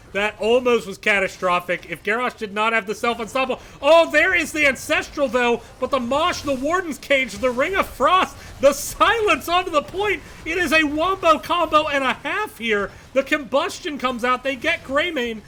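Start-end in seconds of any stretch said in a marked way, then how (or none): 3.8-15.4 louder, higher, rapid-fire hype call; excited shout. Real, not acted. Caster hyping the wombo combo for the crowd, excited. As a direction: yell out each ability as it lands.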